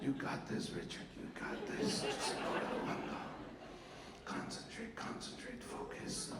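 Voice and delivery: unvoiced pep talk to himself; whispered self-talk. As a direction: whisper it to yourself, trying to focus. Drunk teen psyching himself up.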